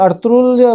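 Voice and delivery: "ارطغرل" as Arturul, g left out; silent-G pronunciation. Shown as a demonstration. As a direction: say "ارطغرل" without any g, ending -rul. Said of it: "جی کو silent کر کے ارطغرل بولتے ہیں".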